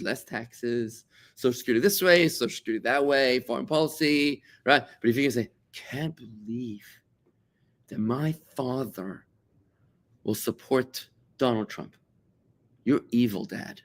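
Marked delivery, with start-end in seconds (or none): 5.7-13.8 slow, hushed mock dismay; emotional accusation. Child accusing father over his politics, emotional. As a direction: act out wounded disbelief, softly and slowly. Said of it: "that's all emotional".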